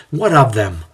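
a touch of frustration; understated exasperated question; frustrated concession. A narrator's reading. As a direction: ask it with mild impatience. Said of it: "concedes in frustration".

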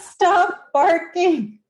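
slow giggly retelling; slow amused quote. Retold or imitated. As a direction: stretch it out, laughing inside. Retelling Muppet dog scene.

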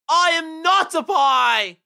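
high, loud, drawn-out yell; huge stretched-out shout of defiance; defiant battle cry. Acted. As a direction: bellow it slowly as a rallying cry. Trapped rabbit's climactic battle cry.

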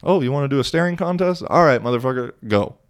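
fast, high taunting dare; mock challenge. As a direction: throw down the challenge, cocky. Retold staring contest challenge.

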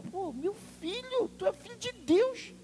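high-pitched appeal; incredulous protest. Acted. Pregador protesta com Jesus. (protesto agudo, incrédulo)

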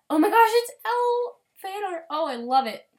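high loud fangirl excitement; excited squeal. Real, not acted. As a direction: squeal with delight at the art. Reacting to L fan art.

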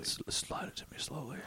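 whispered echo; really quiet. Retold or imitated. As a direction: whisper it as his careful instruction. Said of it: "like, really quietly is like".

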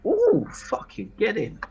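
thrilled yelp of delight; excited whoop. Real, not acted. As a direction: celebrate the roll like a win. Player celebrates the dice roll.

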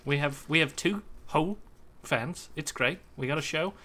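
lightly done New Zealand band manager; a mild Kiwi accent as a cheerful manager. Retold or imitated. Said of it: "Give me yours, [name]".

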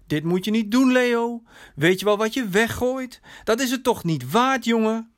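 licht gespeelde vriend, dringend en hoger; bezorgde beste vriend. Acted. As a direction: praat je vriend dringend om, iets verhoogd. (bezorgde, waarschuwende vriend)

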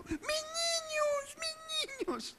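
very high, drawn-out mock sob; keen it like an exaggerated weeping mother; mock wailing. Preacher mimicking people crying at tent doors, falsetto mock wail. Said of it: "Y cada uno llorando".

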